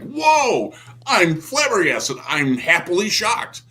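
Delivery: high, slow amazed exclamation; happy surprise. Retold or imitated. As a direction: gasp it out delighted. Quoting his own reaction.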